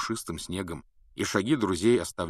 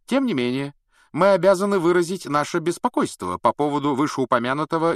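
raised, formal reading voice; official letter; 9 semitones higher, 6 dB louder than usual. A narrator's reading. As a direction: read out the letter stiffly.

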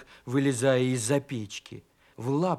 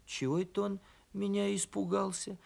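softer, higher musing to oneself; puzzled inner thought; 4 semitones higher, 7 dB quieter than usual. A narrator's reading. Donkey wondering why the hare fled, softly puzzled. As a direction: think it aloud quietly, puzzled. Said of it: "подумал ослик".

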